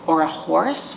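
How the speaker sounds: ordinary delivery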